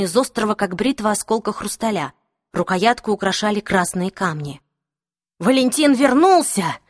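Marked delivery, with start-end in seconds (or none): 5.4-6.8 raised, drawn-out desperate cry; cried out. A narrator's reading. Captive trying to free his hands, crying out. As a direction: call it out slowly and higher. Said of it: "выкрикнул пленник".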